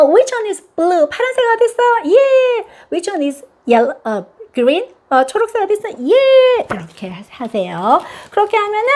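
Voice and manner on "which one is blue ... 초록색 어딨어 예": high, bright teacher-mom voice; high cheerful quiz. Shown as a demonstration. Mom quizzing toddler on colors, high and cheerful. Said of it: "요거 아직 안 되는 친구들은".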